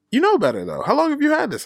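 high scolding nurse, imitated; imitating a nurse telling him off; mock nurse scolding. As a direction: pitch up and chide like the nurse. Nurse lecturing, retold in story.

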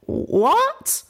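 very high, drawn-up questioning voice; high-pitched, rising disbelief; bewildered question. Acted. Little Pip looking around at a friend, bewildered. Confused character asks again.

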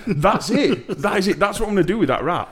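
high, laughing excitement; excited laughing. Real, not acted. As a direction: burst out gleefully laughing. Excited laughing burst over rat idea.

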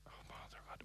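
hushed nervous whisper. Real, not acted. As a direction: breathe the words without voice. Whispered aside waiting for result.

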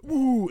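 high, loud "woo" from a crowd; crowd whoop. Retold or imitated. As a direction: whoop like a cheering carriage. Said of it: "everyone's like".

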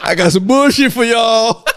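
loud laughing tease; laughing shout. Real, not acted.

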